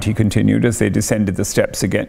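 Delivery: plain reading voice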